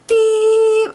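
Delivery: loud, high imitated horn beep. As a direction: blast it like a car horn. Other driver honking again.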